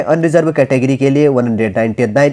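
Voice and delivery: usual speaking voice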